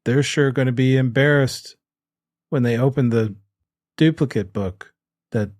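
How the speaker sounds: halting, stilted bad acting; word-by-word, long pauses, painfully staged; Gary. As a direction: read it out like a hopeless actor. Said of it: "'cause Gary does not".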